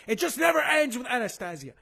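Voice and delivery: high-pitched exasperated cry; exasperated outburst. Acted. Host fed up with Anastasia's endless pouting photos, exasperated outburst. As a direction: burst out, fed up with it all.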